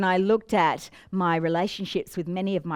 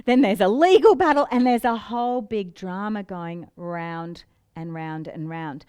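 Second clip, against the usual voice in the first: ordinary talk, nothing marked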